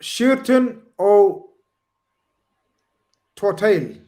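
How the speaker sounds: Irish accent, high and slow. Shown as a demonstration. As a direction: say "Suirton O Tortail" in a drawn-out Irish accent. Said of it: "I tried to do an Irish accent".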